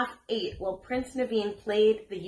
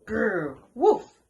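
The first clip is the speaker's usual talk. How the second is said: growl, then high loud yap; growl and bark; 3 semitones higher, 6 dB louder than usual. A narrator's reading. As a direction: imitate a scared little dog barking. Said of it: "Stella began to bark".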